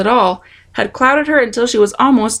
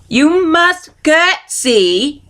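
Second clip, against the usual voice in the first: shouted slowly; 6 semitones higher, much slower than usual. A narrator's reading. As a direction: shout each word slowly. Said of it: "shouted Louise".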